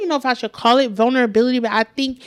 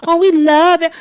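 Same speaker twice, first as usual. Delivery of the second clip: building, louder and higher cooing; mock doting; 4 semitones higher, 4 dB louder than usual. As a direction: imitate fussing over a little sister. Mocking comics who infantilize her.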